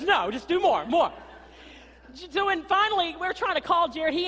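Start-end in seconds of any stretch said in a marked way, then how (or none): 0.0-1.1 husband's voice. Retold or imitated. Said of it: "and he goes".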